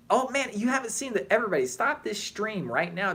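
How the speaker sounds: high-pitched fannish excitement; real excited urging. Real, not acted. Streamer telling viewers to go see the Picard teaser, excited. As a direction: burst out with urgent enthusiasm, voice up.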